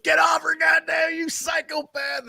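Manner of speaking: high, frantic mock shout; hammed-up panicked shout; mock frantic yell. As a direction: yell it like pulling someone off a fight. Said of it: "he's like".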